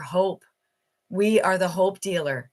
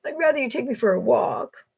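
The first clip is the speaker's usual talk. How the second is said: high-pitched voice for a pleading dog; talking dog; 8 semitones higher than usual. Pretend. Dog begging its owner for a walk, high put-on voice. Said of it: "your dog looks at you and goes".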